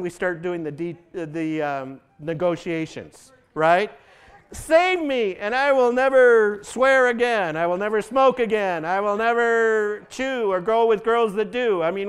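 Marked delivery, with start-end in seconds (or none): 4.5-11.8 loud, high, pleading promises; pleading bargain. Pretend. A desperate sinner bargains with God, loud, high and pleading. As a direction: bargain with God, pushing hard.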